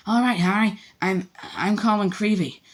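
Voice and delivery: put-on high, eager, breathless boy; gushing it out in a rush of awe; breathless young fan, stumbling over his name. A narrator's reading. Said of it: "he said breathlessly".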